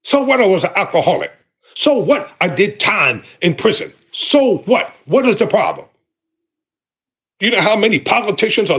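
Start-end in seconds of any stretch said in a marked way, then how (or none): 0.0-5.9 high, punchy So what? each time; defiant so what. Pretend. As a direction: brush off every accusation. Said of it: "talk plainly to people".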